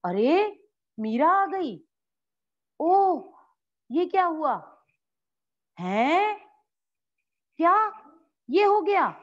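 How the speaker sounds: shocked exclamations; surprise. Shown as a demonstration. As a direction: gasp it out, amazed. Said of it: "हमारे आश्चर्य को प्रकट करता है".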